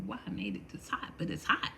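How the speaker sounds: whispering it's hot; whispered. Real, not acted. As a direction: breathe it under the tea. Sipping hot tea.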